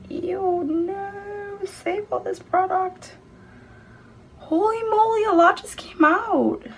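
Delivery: high, squealing ew at the mess; squeaky dismay. Real, not acted. Too much lipstick pops out, high squeaky dismay. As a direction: wail over the wasted lipstick.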